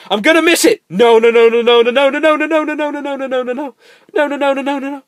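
very high, loud frantic string of no; panicked no's. Real, not acted. Player racing to catch sunrise, frantic high no's. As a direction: spiral into panic.

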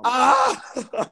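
very high-pitched shriek; sudden high yelp; high laughing scream. Real, not acted. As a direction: scream out before cracking up.

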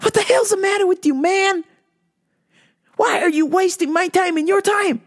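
high, fast, fed-up questioning; exasperated rant. Real, not acted. Scolding men on the app, exasperated high rant. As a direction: demand answers in disbelief. Ranting at men posing as women.

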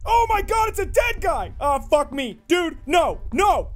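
high, loud cry of horror; real frightened shouting; horrified. Real, not acted. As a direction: recoil in panicked shock. Finds a dead body.

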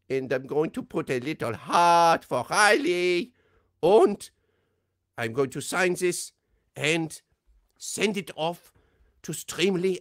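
put-on high, loud German-accented doctor; Medic character voice. Reading a fan's request as the Medic. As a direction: play the excited German Medic. Recorded Medic video message.

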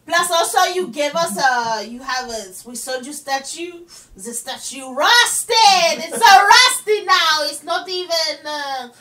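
loud, indignant fake French; mock French accent. French giver complains about the rusted statue, mock French accent. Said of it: "why am I doing the French accent".

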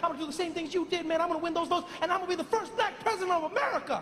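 high, fast eager boasting; Barack. Acted. Young Obama promising his idol, high excited babble. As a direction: race through promises in a high voice. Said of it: "And Barack was like".

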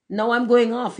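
high, loud announcement; cried out. A narrator's reading. Rocket as the fire reaches him, crying out. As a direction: call it out excitedly. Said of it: "he cried".

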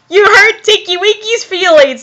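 lightly voiced wounded toy character; hurt Teletubby. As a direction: pipe up as a hurt little creature.

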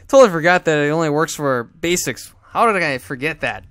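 annoyed at his own mistake; frustrated groan of a complaint; loud self-scolding. Real, not acted.

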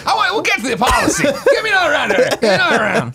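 high, rowdy salesman at a bar; Josh Macuga. Acted. Host imagines guest selling policies in an Ohio hotel bar, rowdy mock shouting. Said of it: "how much fun Josh Macuga would be".

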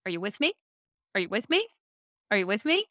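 "Are you with me?" lifting sharply on "me"; imitated presenter's tic, upturned "me" every time; repeated presenter catchphrase. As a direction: check in with a rising "me", pausing after each. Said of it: "how many times this guy said it".

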